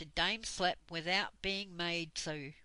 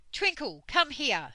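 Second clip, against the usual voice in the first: louder, higher sharp call of the cross dame; stern summons; 7 semitones higher, 8 dB louder than usual. A narrator's reading. Dame Slap calling the untidy pixie over, stern. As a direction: snap the pixie's name like a strict headmistress.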